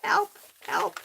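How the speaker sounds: high, playful fake cry for help; mock cry for help. Crafter stuck to the tape, playfully calling for help. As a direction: squeal it like a pretend damsel in distress.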